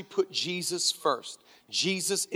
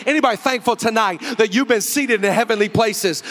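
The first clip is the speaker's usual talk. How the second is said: preaching at full force; 3 semitones higher, 6 dB louder than usual. Acted.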